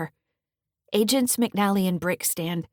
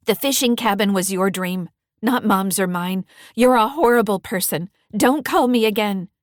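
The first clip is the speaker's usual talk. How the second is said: louder, rising anger; angry; 3 semitones higher, 8 dB louder than usual. Acted. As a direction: tell him off and hang up. Angry refusal on the phone.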